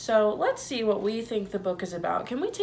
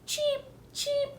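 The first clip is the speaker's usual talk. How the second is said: very high squeaky cheeping; chick cheeps; 5 dB quieter, slower than usual. A narrator's reading. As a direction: peep like tiny chicks.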